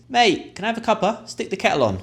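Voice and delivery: high, bright matey ask for a cuppa; chummy British request. Shown as a demonstration. Friendly Brit asking a mate for tea, high and lively. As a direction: sing it out to your mate like a cheeky Brit.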